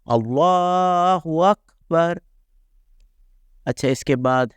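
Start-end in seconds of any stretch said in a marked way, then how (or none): 0.1-2.2 high, slow chanted Allah Akbar; recited takbir. Shown as a demonstration. Teacher demonstrating recitation, slow high chant. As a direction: intone Allah Akbar slowly as in prayer. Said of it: "ایسے پڑھا جائے گا".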